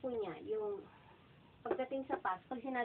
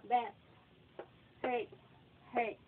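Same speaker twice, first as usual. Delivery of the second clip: normal talking voice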